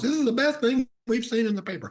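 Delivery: high-pitched mock enthusiasm; excited callers. Newspaper readers phoning in about the story, excited.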